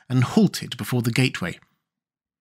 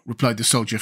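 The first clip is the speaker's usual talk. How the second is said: ordinary delivery, nothing marked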